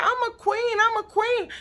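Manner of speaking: high mocking whine of I'm a queen; mocking her hollering. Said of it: "She kept hollering about".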